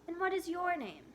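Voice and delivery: high, friendly man's question; friendly shopkeeper. A narrator's reading. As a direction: ask kindly. Shopkeeper voiced in story.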